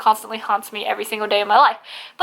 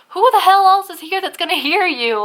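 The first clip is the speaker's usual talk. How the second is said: higher-pitched, incredulous exasperation; exasperated; 6 semitones higher than usual. Retold or imitated. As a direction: throw it back at a needless whisperer, exasperated. Exasperated retort to whispering grandmother.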